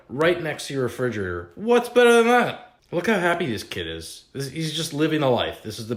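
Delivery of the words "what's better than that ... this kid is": oversell it like a cheesy infomercial; mock excitement. Mock hype of toy kitchen.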